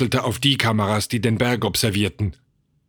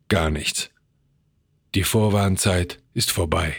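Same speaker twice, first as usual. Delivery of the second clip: low, sinking voice; resigned; 4 semitones lower than usual. A narrator's reading. George answering that time is up, resigned. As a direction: let it drop, all hope gone. Hopeless answer to desperate question.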